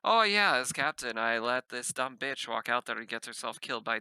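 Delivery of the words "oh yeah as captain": ordinary delivery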